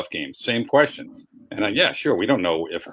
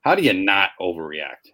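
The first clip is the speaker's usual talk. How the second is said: ordinary talk, nothing marked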